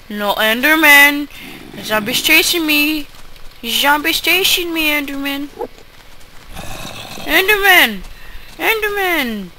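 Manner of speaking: high, loud panicked calls for help; frantic pleas while being chased; panicked pleading. Real, not acted. Zombie chasing, calls for help.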